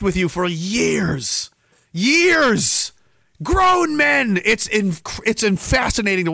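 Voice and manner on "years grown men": high incredulous outburst; incredulous exclaim. Real, not acted. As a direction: exclaim it in disbelief. Exclaiming in disbelief.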